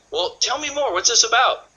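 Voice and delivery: high-pitched, eager questioning; curious prospect. Acted. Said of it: "They're gonna go".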